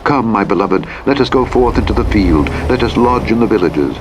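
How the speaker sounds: ordinary delivery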